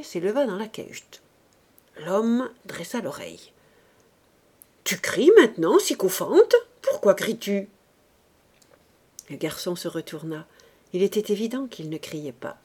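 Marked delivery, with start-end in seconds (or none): 4.8-7.7 loud, irritated challenge; indignant questioning. Acted. Man confronts the boy he thinks is crying, indignant. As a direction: demand an explanation, exasperated.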